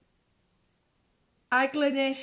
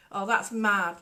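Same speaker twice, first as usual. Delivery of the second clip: usual speaking voice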